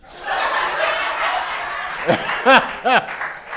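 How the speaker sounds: a long amused laugh at his own joke. Real, not acted. Preacher laughs at sardines memory.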